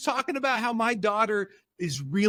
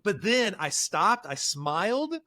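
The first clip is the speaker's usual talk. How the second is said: usual speaking voice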